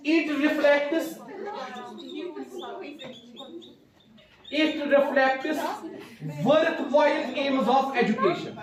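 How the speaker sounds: very slow, phrase by phrase with long pauses and a small laugh; teacher spelling out a definition for students to write down; slow dictation. Shown as a demonstration. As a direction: dictate it in pieces for note-taking. Teacher dictating second point.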